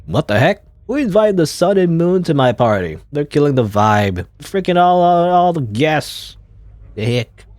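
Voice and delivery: loud, high indignant host; annoyed count. Acted. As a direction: complain as the host whose party is ruined. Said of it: "oh, here's Count Masco".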